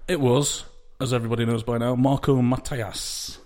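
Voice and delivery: ordinary delivery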